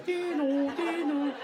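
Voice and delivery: high sing-song ding-dong of the subway doors; door chime imitation. Comic mimicking the subway door chime.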